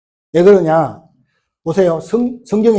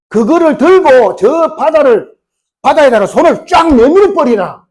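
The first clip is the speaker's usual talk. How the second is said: slow, loud, raised command; God's command; 7 semitones higher, 6 dB louder than usual. Acted. God ordering Moses to stretch out his hand, in a slow booming voice. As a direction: stretch the order out with divine force. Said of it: "그러니까 하나님은 합니까?".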